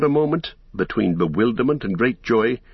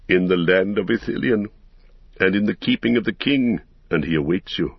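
plain reading voice

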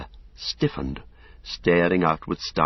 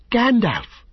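much higher and louder, flung out; joyful cry; 4 dB louder than usual. A narrator's reading. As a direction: hail him with relief. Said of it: "he cried".

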